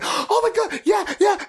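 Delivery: very high, thrilled little-boy imitation; excited kid. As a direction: squeal like a kid suddenly coming to life. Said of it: "he just came to life".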